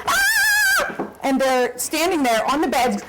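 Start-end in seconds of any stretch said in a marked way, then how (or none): 0.1-1.1 very high shrieked ah; hammed-up high scream; mock scream. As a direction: shriek like a grown man terrified of a spider.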